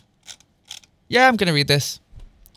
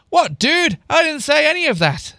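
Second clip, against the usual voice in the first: much higher, indignant denial; high-pitched protest; 10 semitones higher than usual. A narrator's reading. Girl denies her sister's accusation, high protesting. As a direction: protest it in a raised, acted pitch. Acted protest in mock quarrel.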